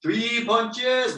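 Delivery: ordinary talk, nothing marked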